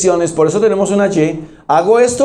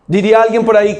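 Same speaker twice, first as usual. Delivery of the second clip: ordinary talk, nothing marked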